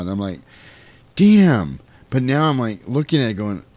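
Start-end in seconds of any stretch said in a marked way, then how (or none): 1.1-1.8 high, loud, drawn-out reaction; impressed exclamation. Retold or imitated. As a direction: let one word burst out, impressed. Reaction to a film, acted.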